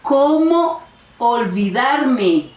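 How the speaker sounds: very slow exclamation, CÓMO stretched long. Shown as a demonstration. Teacher exclaiming to herself over something forgotten, slow exclamation. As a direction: draw out CÓMO, then let OLVIDARME fall. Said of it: "Cuando es admiración".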